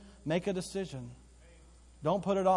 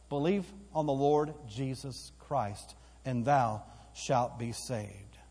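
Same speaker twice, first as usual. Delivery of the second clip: slow emphatic verse; 2 semitones lower, slower than usual. Shown as a demonstration. Preacher repeats key verse.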